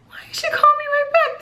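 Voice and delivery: very high, squeaky retold bewilderment; puzzled disbelief. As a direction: act out baffled surprise in a tiny high voice.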